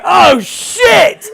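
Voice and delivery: loud, high shocked oh shit; shouted curse. Retold or imitated. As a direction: blurt it out in shock. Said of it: "I was just like".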